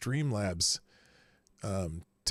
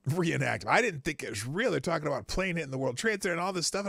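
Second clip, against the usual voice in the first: ordinary delivery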